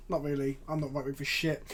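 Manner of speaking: usual speaking voice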